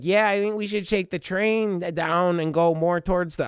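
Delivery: exaggerated high-pitched American girl; American accent. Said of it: "American accent".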